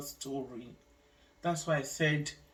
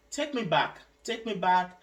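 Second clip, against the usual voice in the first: pleading louder, higher; retold pleading; 3 semitones higher, 7 dB louder than usual. Woman begging staff to take her back, loud plea. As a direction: beg loudly to go back.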